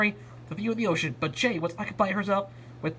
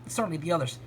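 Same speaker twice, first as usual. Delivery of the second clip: ordinary delivery, nothing marked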